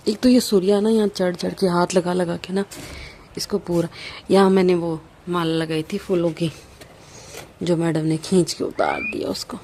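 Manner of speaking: ordinary delivery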